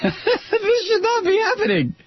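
very high, laughing mock voice; mocking squeal. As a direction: parrot it in a ridiculous high pitch. Repeats it in high, laughing mockery.

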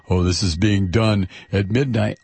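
low-pitched mocking complaint; mock whining. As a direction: imitate a grumbling senator. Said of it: "and whining about".

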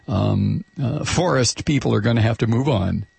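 ordinary talk

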